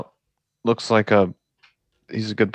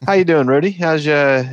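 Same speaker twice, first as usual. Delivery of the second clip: upbeat Australian coach voice in the huddle, pitched higher; Australian accent; 4 semitones higher than usual. Retold or imitated. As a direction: do a cheerful Aussie Joe Ingles. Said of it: "I need to work on my Australian accent".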